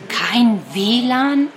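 a shocked, slow question; slowly, unable to believe it; startled. A narrator's reading. Martha learning there is no wifi, startled and slow. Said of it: "fragt Martha erschrocken".

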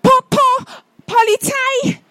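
high, stammering caretaker; breathless stutter, pitched high; excited stutter. Acted. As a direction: stumble over the first syllable in a panic. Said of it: "stottert der Hausmeister aufgeregt".

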